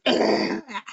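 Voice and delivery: voiceless disgusted ah; breathy disgusted huff. Shown as a demonstration. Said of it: "you might make a disgusted face".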